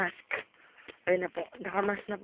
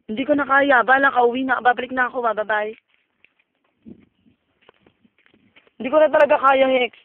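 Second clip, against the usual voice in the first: scared and loud; 5 semitones higher, 12 dB louder than usual. Real, not acted. Afraid on the volcano trail.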